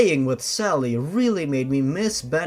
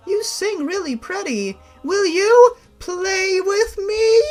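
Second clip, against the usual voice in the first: put-on much higher, slow sweet little-girl voice. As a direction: play a small eager child asking to play. Little girl Sally speaks.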